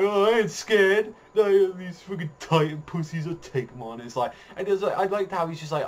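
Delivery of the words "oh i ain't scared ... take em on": high, loud blustering denial; mock bravado. Imitating Eren answering friends who ask if he's scared, mock bravado.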